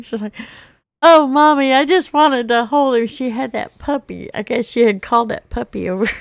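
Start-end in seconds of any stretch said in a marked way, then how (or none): 1.0-3.1 put-on high, louder little-girl plea; pleading child. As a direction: plead like a small girl caught out.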